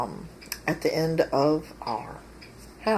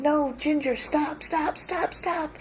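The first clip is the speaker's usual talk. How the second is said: retold yell; 10 semitones higher, slower than usual. Owner calls dog Ginger away from squirrel, mock yell. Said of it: "So I'm yelling".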